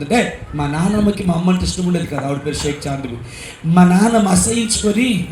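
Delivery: ordinary talk, nothing marked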